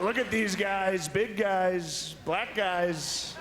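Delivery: high, slow mock Trump voice; Trump impression. Comic imitating the president greeting a team, slow and high. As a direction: drawl it out like Trump greeting athletes. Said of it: "he's like".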